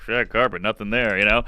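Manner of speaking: low, dismissive echo; taunting mimicry. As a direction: write the joke off under your breath. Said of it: "they mimic my bomb".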